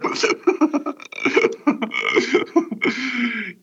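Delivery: long, high peal of laughter; real laugh. Real, not acted. Host laughing at his own timing joke.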